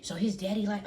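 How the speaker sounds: usual speaking voice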